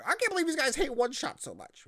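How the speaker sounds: high-pitched imitation of complaining fans; mock outraged critics. Host mimicking listeners upset at his criticism, mock outrage. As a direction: put on a high whiny complainer voice. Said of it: "they're gonna be so like".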